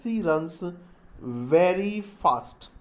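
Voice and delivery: stretched out very slowly; very slow, clear dictation. Shown as a demonstration. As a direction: dictate the phrase slowly for writing. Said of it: "एक example हम और दे दें".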